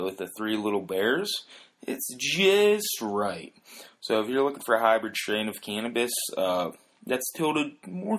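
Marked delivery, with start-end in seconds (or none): 1.9-3.5 slow, drawn-out just right, a touch higher; Goldilocks saying it. Retold or imitated. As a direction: stretch it out like a storybook punchline. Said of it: "as Goldilocks would say".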